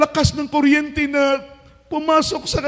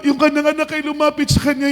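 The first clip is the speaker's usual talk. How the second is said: normal talking voice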